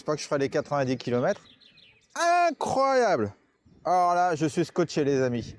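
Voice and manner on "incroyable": high, drawn-out amazed cry. Real, not acted. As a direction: sound truly blown away. Amazed at the climb.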